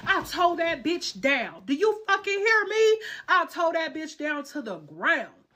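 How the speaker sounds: high, loud, slow boast; bragging; triumphant boast. Real, not acted. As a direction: drag it out, proud and loud. Boasting about beating her.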